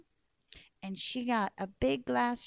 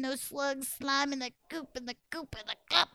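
ordinary delivery

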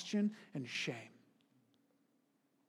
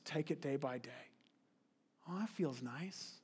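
mock soothing; 5 semitones lower, 13 dB quieter than usual. Mocking soothing quote.

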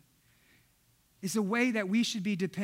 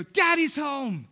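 put-on drawn-out, louder happy child's shout; imitating an excited daughter calling out; excited little daughter; 3 semitones higher, 4 dB louder, slower than usual. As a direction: shout it like a child greeting dad. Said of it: "I hear Esther go".

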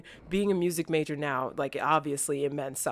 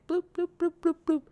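playful bloop noises; 12 semitones higher, faster than usual. Retold or imitated. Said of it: "be like".

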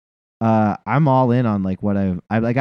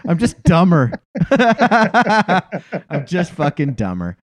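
higher pitch, breaking into laughter; laughing self-mockery; 4 semitones higher, much slower than usual. Real, not acted. Host mocks himself to his co-host, laughing hard. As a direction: crack up while putting yourself down.

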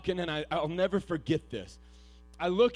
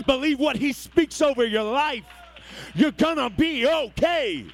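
louder and higher, driving each line home; preaching at full force; 7 semitones higher, 11 dB louder than usual. Acted. Preacher rallying the young people, at full force.

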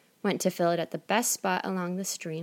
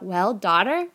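a louder eager call; 6 dB louder than usual. Acted. Mother greets the rude daughter coming home, louder eager call. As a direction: call out to her returning daughter. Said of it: "she cried out".

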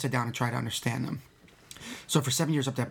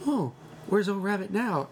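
high, slow cooing question; gentle puppet voice; 6 semitones higher, slower than usual. Retold or imitated. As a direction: coo it slowly like talking to a child through a puppet. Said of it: "he was like".

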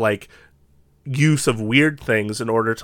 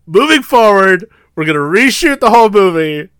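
director announcing; 7 semitones higher, 6 dB louder than usual. Pretend. Mock director announcing reshoot.

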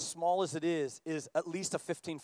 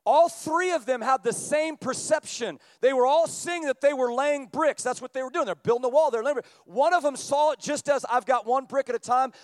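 loud, higher, driving sermon voice; preaching at full force; 7 semitones higher, 7 dB louder than usual. Acted. Pastor pressing the point on seeing with the heart, loud preaching. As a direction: preach it out to the back row. Preaching the bricklayer lesson loudly.

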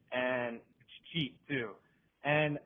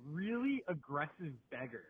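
ordinary talk, nothing marked

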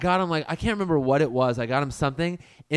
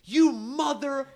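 mock scream; 12 semitones higher than usual. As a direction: shriek it in a retold mock yell. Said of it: "He starts screaming at me".

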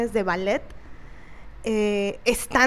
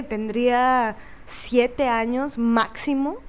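ordinary talk

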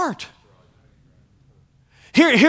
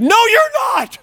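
high, loud mock contradiction; squawked-up comic denial; mock outraged rebuttal; 11 semitones higher, 4 dB louder, slower than usual. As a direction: cry out in playful disbelief, voice shooting up.

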